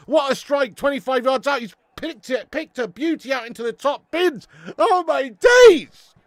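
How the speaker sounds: loud, buzzing, still celebrating; hyped replay of the goal; excited commentary. Real, not acted.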